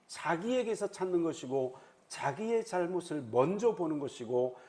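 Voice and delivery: ordinary talk, nothing marked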